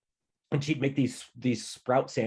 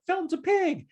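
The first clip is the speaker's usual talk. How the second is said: high-pitched mock shout; narrated shout. A narrator's reading. Little brother taunts the narrator at home, high mock shout. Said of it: "Andrew would shout".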